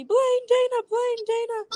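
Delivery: put-on high, loud excited kids shouting the names; kids calling out. Kids at Playlist chasing the couple, high excited calling. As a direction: call out like an eager young fan. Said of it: "kids run up to us like crazy".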